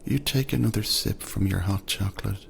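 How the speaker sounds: usual speaking voice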